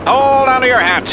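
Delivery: high-pitched excited announcer; excited play-by-play call. Acted. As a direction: call out the coming danger. Excited call as stomp begins.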